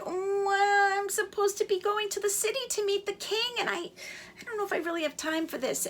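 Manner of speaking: hesitant chick. A narrator's reading.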